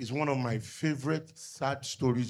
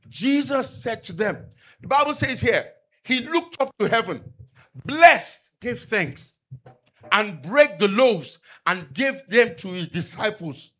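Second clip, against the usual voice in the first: raised, louder preaching voice; fervent sermon delivery; 7 semitones higher, 6 dB louder, slower than usual. Acted. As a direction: proclaim it to the congregation. Preaching the loaves story.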